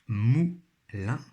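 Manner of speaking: "Moulin" split into mou, pause, lin; syllable by syllable. Shown as a demonstration. Teacher helping pupils find the syllable, syllable by syllable. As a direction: sound out "Moulin" slowly in two beats. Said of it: "Toujours première syllabe".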